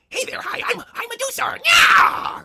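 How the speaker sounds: squeaky high flirty monster voice; sing-song come-on in a very high voice; Medusa impression.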